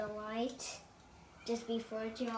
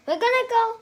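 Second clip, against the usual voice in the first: excited call; 12 semitones higher, 15 dB louder, faster than usual. Real, not acted. Announcing the next toy.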